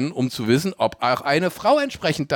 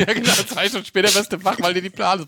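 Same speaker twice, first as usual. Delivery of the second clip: laughing; 6 semitones higher, faster than usual. Real, not acted. Laughing while talking.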